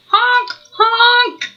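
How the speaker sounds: high goose honks; honking. Acted. Reading to a child, honking high like a goose. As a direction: honk it out like a silly duck.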